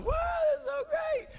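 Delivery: wild party cheer from up top; ecstatic party guy. Acted. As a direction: shout it like you're swinging from the chandelier. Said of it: "a guy hanging from the chandeliers at the top going".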